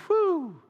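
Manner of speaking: relieved whew. Retold or imitated. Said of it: "you're like".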